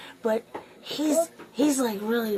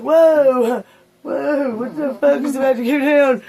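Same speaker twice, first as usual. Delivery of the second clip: alarmed whoa; 3 semitones higher, 8 dB louder, slower than usual. Retold or imitated. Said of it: "I was like".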